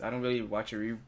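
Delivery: ordinary talk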